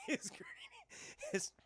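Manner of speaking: laughing. Real, not acted. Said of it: "laughing mid-reading".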